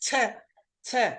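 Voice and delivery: "T" as a short "tuh", not the letter name; phonics sound demo. Shown as a demonstration. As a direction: sound out "T" crisply, pausing between repeats. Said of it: "and then I go".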